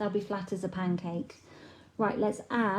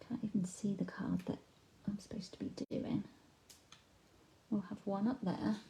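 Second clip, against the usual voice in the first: whispering; 9 dB quieter than usual. Real, not acted. Muttering to herself mid-craft.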